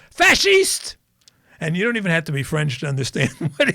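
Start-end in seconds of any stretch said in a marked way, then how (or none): 0.1-0.9 high, loud imitated yell; sudden high-pitched holler; yelled insult. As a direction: shout it like an outraged driver. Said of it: "my host yells at him".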